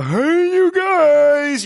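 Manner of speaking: high, loud, strained bellow; booming clumsy giant call; Sloth from The Goonies. Retold or imitated. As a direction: yell it like the big lovable Goonies monster. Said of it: "the sloth at that one part is going".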